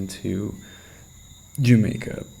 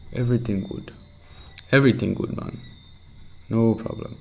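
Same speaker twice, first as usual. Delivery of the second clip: a bad put-on Jamaican accent, a bit louder; shitty Jamaican accent; 2 semitones higher, 3 dB louder than usual. Shown as a demonstration. Practising phrases learned tonight, clumsy Jamaican accent. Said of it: "doing a shitty Jamaican accent".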